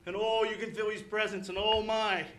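impassioned pulpit delivery with loud bursts; emphatic, heartfelt revival preaching; fervent preaching. Acted. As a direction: preach it with conviction to the saints. Preaching with rising fervour.

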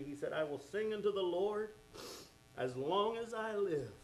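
ordinary delivery

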